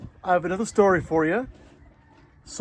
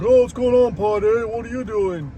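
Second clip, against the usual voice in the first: owl voice; 4 semitones higher than usual. Acted. Owl character speaks.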